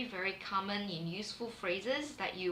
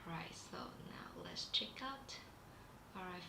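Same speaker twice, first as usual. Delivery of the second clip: whispering; 12 dB quieter than usual. Real, not acted. Quiet aside while navigating site.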